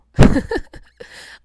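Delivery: loud high whooping cry; wild whoop. A narrator's reading. La Petite rallying the canoes, loud whoop. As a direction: let out a wild cheer.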